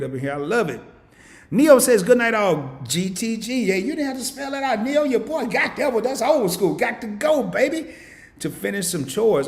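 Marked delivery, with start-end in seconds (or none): 1.5-9.5 excited chat reading. Real, not acted. Host reads chat excitedly.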